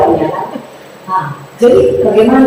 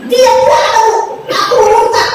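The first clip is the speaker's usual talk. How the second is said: high, pleading cry of a broken woman; desperate woman's lament; 8 semitones higher than usual. Retold or imitated. Despairing wife tells the pastor God is gone, high pleading wail. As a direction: wail that God has left her. Woman declares she has apostatized.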